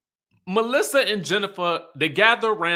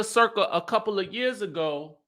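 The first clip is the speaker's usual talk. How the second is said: ordinary talk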